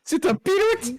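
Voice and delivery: high, loud incredulous echo. Real, not acted.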